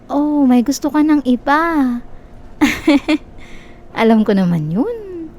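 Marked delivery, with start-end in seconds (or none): 1.7-3.6 higher, drawn-out giggle. Acted. Laughs off his other crush. (mataas na hagikhik)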